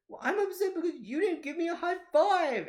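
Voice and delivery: high, loud mock wail; whiny crying kid. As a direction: imitate a wounded child protesting. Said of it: "Why are you crying?".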